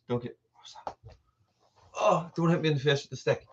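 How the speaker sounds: usual speaking voice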